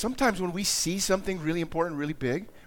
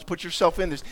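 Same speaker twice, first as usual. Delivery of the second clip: usual speaking voice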